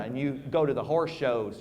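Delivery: usual speaking voice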